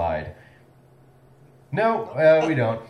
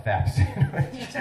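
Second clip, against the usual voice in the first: real laugh breaking through; failing straight face; 7 semitones higher, slower than usual. Real, not acted. Host thanking fake science associates, cracking up. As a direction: lose your composure on the silly name. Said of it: "can't say it with a straight face".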